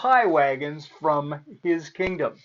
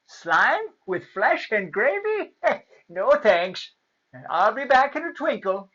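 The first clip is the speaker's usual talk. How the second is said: high-pitched, muddled and chuckling; dotty hard-of-hearing fairy; half-deaf fairy; 6 semitones higher than usual. A narrator's reading. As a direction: mishear it, then brush it off with a laugh. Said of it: "who was half deaf".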